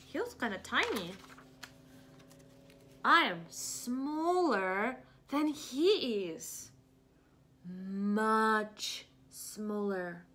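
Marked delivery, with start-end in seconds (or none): 3.0-10.2 slow, drawn-out elephant voice, only a little changed; lightly voiced Gerald. Reading to kids, Gerald admitting the whale is bigger. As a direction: stretch out smaller like a humbled elephant.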